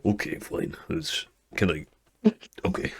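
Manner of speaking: soft, deflated mumble; sulking. Acted. Drake gives up the rematch, sulking and soft. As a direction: give in, grumbling quietly. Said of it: "He goes".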